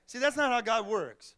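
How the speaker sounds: usual speaking voice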